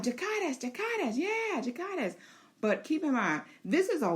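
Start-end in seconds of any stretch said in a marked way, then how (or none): 0.0-2.1 high, pleading repeated command; high coaxing chant of the order. Pretend. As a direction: keep urging the dragon to fire. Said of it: "And she's like".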